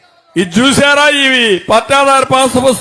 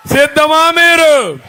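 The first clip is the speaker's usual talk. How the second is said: slow, drawn-out shout to the crowd; rally call; 6 semitones higher, slower than usual. Real, not acted. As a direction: call out and wait for the roar. (సభికులను అడుగుతూ నెమ్మదిగా గట్టి అరుపు)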